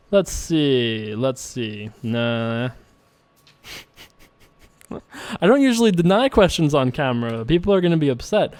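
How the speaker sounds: ordinary talk, nothing marked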